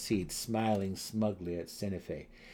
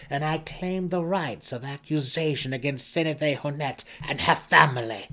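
louder, ringing claim of victory; proud claim; 6 semitones higher, 7 dB louder than usual. Acted. Malice proclaiming her claim before the council, loud and high. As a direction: stand and proclaim the right.

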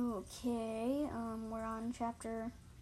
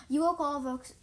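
mildly scolding, louder at the start; understated loud complaint; loud hiss; 2 semitones higher, 6 dB louder than usual. A narrator's reading. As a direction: scold with a forceful opening, then ease off. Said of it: "Smokepot hissed loudly".

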